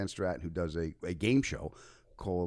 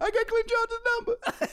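giddy squeal; 5 dB louder than usual. Retold or imitated. Voice jumping up in disbelief. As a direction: squeak it out way up high. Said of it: "And I went".

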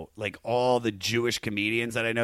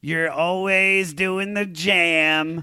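hammy speech; 9 semitones higher, 6 dB louder, much slower than usual. Pretend. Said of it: "when I'm like".

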